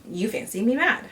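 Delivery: usual speaking voice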